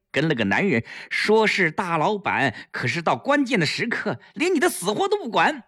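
mock scolding. A narrator's reading. Staged scolding for eavesdropper.

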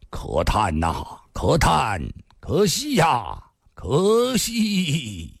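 拖长字音，缓慢地长吁短叹; 先低沉慢叹，到“可惜”时拔高拉长; 叹息哀叹. Acted. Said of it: "也叹了口气". (惋惜悲叹的语气)

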